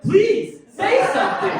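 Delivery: high, loud panicked begging; desperate plea. Retold or imitated. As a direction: beg the lifeguard at full voice. Acted desperate plea to lifeguard.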